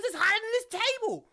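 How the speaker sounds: high, fast exclamation; acted outraged protest. Brother arguing about the rock-hard cookie, fast high protest. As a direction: pitch it way up in mock outrage.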